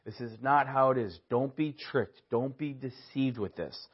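a pastor's quiet heartfelt caution; concerned, from the heart. Acted. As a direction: plead gently with the flock. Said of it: "out of the concern of the heart".

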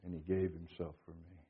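very soft and low; de-emphasized, hushed. Shown as a demonstration. Preacher ending the verse to his congregation, hushed. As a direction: let "gave himself for me" fall almost to nothing. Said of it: "where you put the emphasis".

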